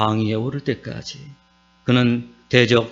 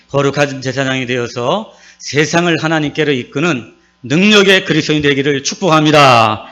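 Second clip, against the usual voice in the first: normal talking voice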